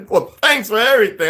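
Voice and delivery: high, loud mock indignation; imitating a worked-up character; outraged movie character. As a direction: throw it out as a hammed-up complaint. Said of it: "the other brother character who said".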